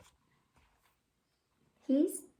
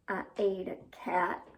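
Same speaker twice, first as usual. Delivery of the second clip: low worm voice; 6 semitones lower, 4 dB quieter than usual. Acted. Voicing Herman the Worm.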